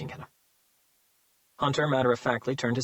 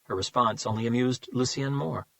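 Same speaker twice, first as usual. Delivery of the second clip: usual speaking voice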